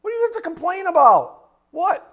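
shouted, high demanding question; incredulous preacher's shout; loud challenge. Acted. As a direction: bark it at the complainers. Preacher mocks complaining, loud.

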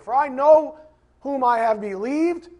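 preacher crying out the verse; fervent scripture reading. Real, not acted. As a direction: lift the verse with passion.

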